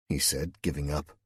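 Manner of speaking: usual speaking voice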